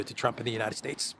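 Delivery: ordinary delivery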